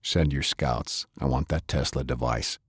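low, quiet orders from a ruthless leader; low, menacing boss sending off his scout; cold commander. Acted. Madi orders Yutaka.

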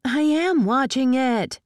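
high, slow, emphatic shout. A narrator's reading. Tinker snaps back while flooring the gas, emphatic shout. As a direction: shout back, stretching each word. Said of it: "she shouted".

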